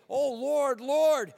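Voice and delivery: loud, high, imploring cry of Lord; pleading Lord Lord. Acted. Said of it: "Many are gonna say".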